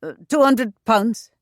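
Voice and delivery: caught-out agent gulping the sum; gulped answer. A narrator's reading. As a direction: blurt it out in distress. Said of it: "he gulped out the answer".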